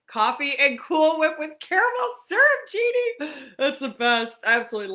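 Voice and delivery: host gushing over a viewer's drink in a mock New York accent; New York Coffee Talk accent. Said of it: "does his aunt's unique New York type vo-, uh, accent".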